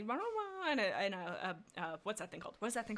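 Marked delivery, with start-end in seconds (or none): 0.0-0.7 high imitated bird call; bird call imitation. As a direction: chirp it like a bird overhead. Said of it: "and everybody".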